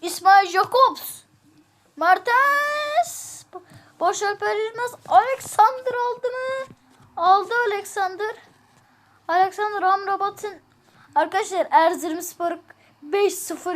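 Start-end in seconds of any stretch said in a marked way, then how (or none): 0.0-10.6 sports announcer. Acted. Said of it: "Spiker gibi konuşuyorum".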